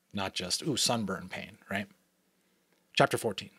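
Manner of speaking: usual speaking voice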